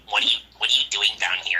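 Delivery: quick, lightly put-on guard voice; a hurried mock challenge from a guard; imitating security guard. Security guard questioning a student in the basement, lightly mimicked. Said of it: "he talks like this".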